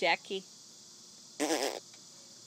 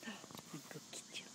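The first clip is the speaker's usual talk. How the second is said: whisper; 5 semitones lower, 21 dB quieter, slower than usual. Real, not acted. Parent teasing baby in a playful whisper. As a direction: gasp then hushed whisper.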